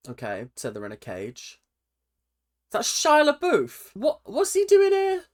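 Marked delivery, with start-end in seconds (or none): 2.7-5.2 high, loud startled exclaim; shocked surprise. Real, not acted. Reacting to Shia in the video, shocked and high. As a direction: gasp out your shock.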